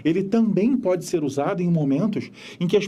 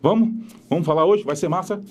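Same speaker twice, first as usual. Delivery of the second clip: heavy northeastern Brazilian accent; stronger regional accent. Shown as a demonstration. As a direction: lean hard into a thick regional accent. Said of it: "com um sotaque mais puxado".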